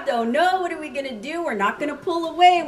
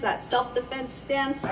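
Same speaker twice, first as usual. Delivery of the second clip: ordinary delivery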